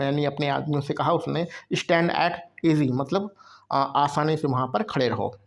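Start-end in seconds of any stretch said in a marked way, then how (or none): 1.7-2.9 slow, even reading of the command; very slow classroom reading; slow dictation read. Shown as a demonstration. Teacher reads quoted command.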